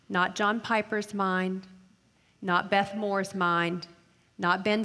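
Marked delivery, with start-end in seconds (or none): none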